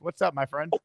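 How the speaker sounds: ordinary delivery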